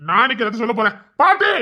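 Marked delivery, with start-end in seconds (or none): 1.2-1.5 high, rising yell; calling out. Acted. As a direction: shout for grandmother. Threatens by calling grandmother.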